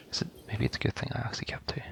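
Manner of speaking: breathy whisper, fast and very soft; whispered aside. Real, not acted. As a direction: mutter it to yourself under your breath. Muttering aside while playing.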